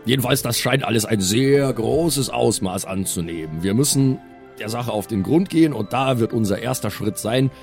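ordinary talk, nothing marked

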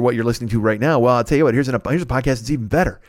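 normal talking voice

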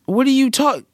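high-pitched, baffled protest; incredulous outburst. Real, not acted. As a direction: burst out high in disbelief. Disbelief in Godfather argument.

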